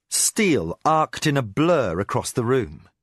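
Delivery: usual speaking voice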